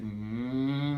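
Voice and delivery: low, long mechanical drone; humming machine. Retold or imitated. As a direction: imitate a machine starting up with a drawn-out hum. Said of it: "and the machine was like".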